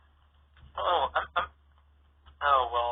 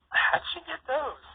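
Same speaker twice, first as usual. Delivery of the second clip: put-on little kid voice; 4 dB louder, slower than usual. Child sibling asks.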